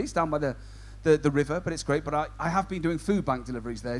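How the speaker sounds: usual speaking voice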